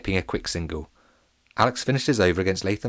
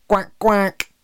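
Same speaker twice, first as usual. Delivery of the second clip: high, loud comic duck quack; playful quack; 12 semitones higher, 6 dB louder than usual. A narrator's reading. Narrator's joke after factoid.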